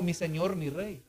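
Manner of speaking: bow your voice to the king; soft humble address. Retold or imitated. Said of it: "La palabra blanda aplaca la ira".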